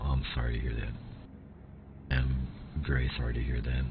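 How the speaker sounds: gently, with real sympathy; sincere sympathy. Real, not acted. Viewer lost their house.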